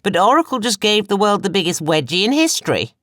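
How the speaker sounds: high, loud, scornful rant; mock them with bitter disbelief. A narrator's reading. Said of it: "continued to laugh hysterically".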